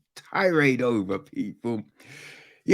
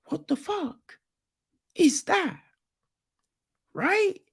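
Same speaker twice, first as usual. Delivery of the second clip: incredulous; 10 semitones higher than usual. Real, not acted. Incredulous rant about podcast nickname.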